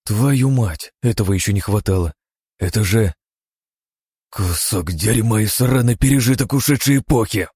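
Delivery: angry growl, getting worked up. Acted. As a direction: snarl it, building rage. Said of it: "прорычал я, начиная заводиться". (раздражённое рычание)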